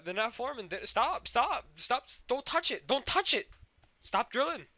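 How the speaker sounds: high urgent repeated cries; mock frantic plea. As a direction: imitate panicking to halt someone. Steven urgently halts the drilling.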